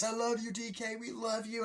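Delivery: mock swooning crowd voices; adoring fans. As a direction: imitate fans calling from the stands. Audience fans call out.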